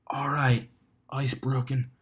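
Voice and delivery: whispered self-congratulation; hushed aside. Acted. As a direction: whisper to yourself, pleased. Inner thought aside.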